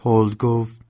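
ordinary delivery

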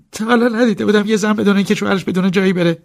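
high, strained, strangled voice; choked voice. Acted. Driver held over the balcony by his master, choking. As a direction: force the words out loudly while being choked. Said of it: "با صدای خفه گفتم".

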